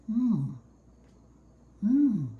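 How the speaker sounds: appreciative mm while chewing; savoring mm. Real, not acted. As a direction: mm of enjoyment over food. Tasting the food just cooked.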